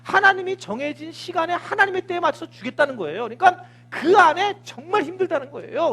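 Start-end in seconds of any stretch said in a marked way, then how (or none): none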